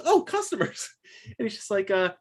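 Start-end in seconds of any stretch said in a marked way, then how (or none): 0.0-0.9 high, eager shopkeeper voice; cabbage man voice. Acted. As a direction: greet the customers high and surprised. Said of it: "And he goes".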